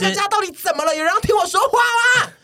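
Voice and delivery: high, loud shout, ringing through the flat; retold shout. Son shouting at his family in the living room, high loud shout. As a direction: yell it so the neighbours hear. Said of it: "我在客厅喊".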